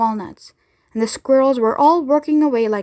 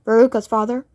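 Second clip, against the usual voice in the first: usual speaking voice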